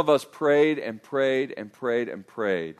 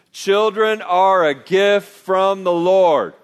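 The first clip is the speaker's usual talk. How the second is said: slow, loud, deliberate recitation; leading group recitation; 5 semitones higher, 6 dB louder, slower than usual. Shown as a demonstration. Pastor leading both campuses together, slow emphatic recitation. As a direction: lead a crowd through it word by word. Leads congregation saying it together.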